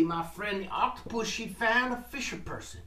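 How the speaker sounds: plain reading voice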